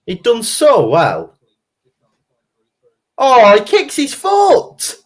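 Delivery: high, slow outraged protest; indignant. Real, not acted. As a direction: plead it was a foul. Outraged at a missed foul.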